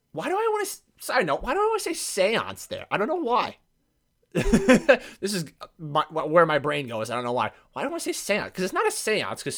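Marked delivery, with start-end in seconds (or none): 4.3-5.1 sudden loud laugh. Real, not acted. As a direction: crack up at yourself. Laughs at own word slip.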